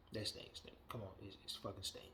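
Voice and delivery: whispered under the breath; whispered reverent aside; hushed aside. Real, not acted. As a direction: drop to a whisper as if in awe. Hushed aside about [name].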